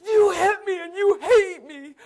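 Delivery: high, loud, crying accusation; tearful accusation. Acted. Margie crying at Lisa, high and loud. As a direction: wail it out like a hurt, confused old woman.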